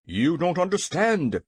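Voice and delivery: nervous retort. A narrator's reading. King explains his fear to the hen, nervous. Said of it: "retorted the little monarch nervously".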